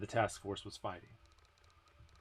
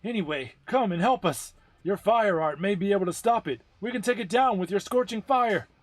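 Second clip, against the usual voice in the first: much higher, much louder pleading call; desperate plea over radio; 10 semitones higher, 10 dB louder than usual. Acted. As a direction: beg her to come help now. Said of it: "how desperate the voice on the radio".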